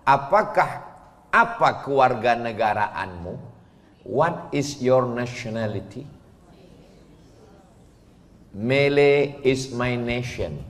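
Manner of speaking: ordinary talk, nothing marked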